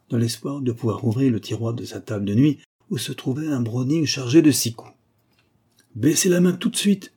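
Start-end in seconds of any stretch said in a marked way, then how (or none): 5.9-7.1 high, imperious command. A narrator's reading. Masked gunman to victim reaching for a drawer, imperious. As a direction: order it like one used to being obeyed. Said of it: "répéta la voix impérieuse de l'homme masqué".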